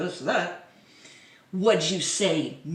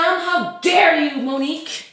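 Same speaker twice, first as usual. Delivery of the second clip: slow, high and loud scandalised scold; mock scolding; 8 semitones higher, 7 dB louder than usual. Vlogger scolding Monique in a dramatic voice.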